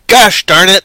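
mock outburst. Mock disappointment at news.

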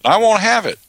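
high, loud mock refusal; squeaky defiant baby; defiant declaration. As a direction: put your foot down loudly. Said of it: "baby demanding rights".

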